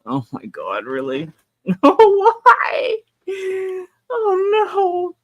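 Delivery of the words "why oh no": high cracking wail of dismay; frantic wail. Real, not acted. Streamer wailing after dropping a toy, high and desperate. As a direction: wail in panic over the lost toy.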